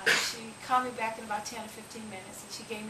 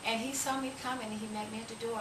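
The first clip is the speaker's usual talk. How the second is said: ordinary talk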